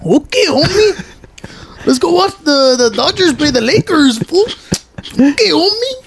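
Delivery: high, slow put-on Brazilian accent; Brazilian impression. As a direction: play an over-the-top Brazilian dude. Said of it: "my impression of a Brazilian".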